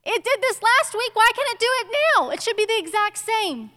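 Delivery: a lightly voiced toddler baffled the car won't fit; imitating frustrated toddler. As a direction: voice the two-year-old stamping his feet. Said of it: "because he's like".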